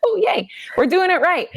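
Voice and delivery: mock delighted cheer. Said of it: "I was like".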